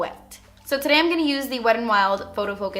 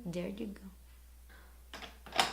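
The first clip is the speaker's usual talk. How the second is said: whispering; 16 dB quieter, much slower than usual. Real, not acted. Applying lip balm, aside.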